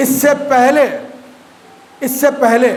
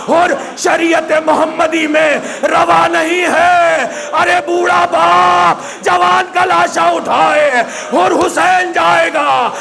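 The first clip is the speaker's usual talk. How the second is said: preaching at full force; 4 semitones higher than usual. Acted. Rising mourning recitation.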